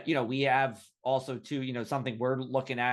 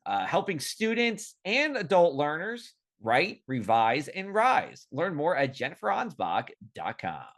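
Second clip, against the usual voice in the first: upbeat radio-host welcome back with lifted pitch; polished on-air sponsor mention; sponsor read; 5 semitones higher than usual. Real, not acted.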